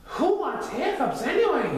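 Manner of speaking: high-pitched animal voice; high cartoony retort; storybook character voice. A narrator's reading. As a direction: pipe it up high, dismissively.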